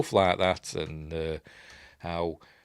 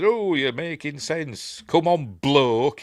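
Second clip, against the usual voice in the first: won-over mate; 6 semitones higher, slower than usual. Retold or imitated. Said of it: "he says".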